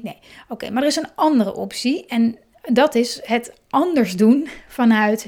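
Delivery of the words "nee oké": ordinary talk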